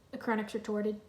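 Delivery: ordinary delivery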